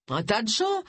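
brisk cutting reply. A narrator's reading. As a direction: settle the question flatly. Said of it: "trancha Doris".

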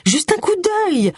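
high, drawn-out plea; pleading. A narrator's reading. Agatha begs her companions for a quick look, pleading. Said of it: "implora Agatha".

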